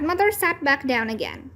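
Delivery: plain reading voice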